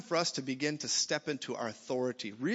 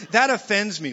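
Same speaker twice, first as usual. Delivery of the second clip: mock offended churchgoer; 6 semitones higher, 12 dB louder than usual. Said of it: "we're like".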